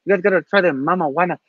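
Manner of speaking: a light put-on of a host's distinct accent; loosely imitating a host's distinct accent, inviting; Manuel's accent. Host urging guests to try the drink, lightly imitated distinct accent. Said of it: "Manuel has a very distinct accent".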